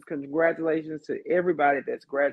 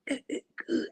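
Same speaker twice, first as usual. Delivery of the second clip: mimicking weak reply; 9 semitones higher, much slower than usual. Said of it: "You gotta speak up".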